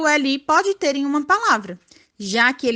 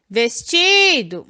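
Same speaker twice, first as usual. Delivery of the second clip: vestido called out, TI stretched long and loud; drawn-out vesTIIIdo, as if calling someone; calling-out stress; 3 dB louder, slower than usual. Shown as a demonstration. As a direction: call "vestido" slowly, holding the stressed ti. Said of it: "a técnica do chamar".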